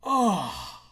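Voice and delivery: loud exasperated sigh; teacher sighs loudly at clumsy Sarah. Acted. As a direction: groan it out in disgust. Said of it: "He sighed loudly".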